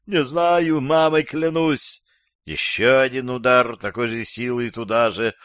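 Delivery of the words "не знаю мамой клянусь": high-pitched, insistent oath; desperate pleading. Acted. Captive swears to interrogator he knows nothing, high pleading.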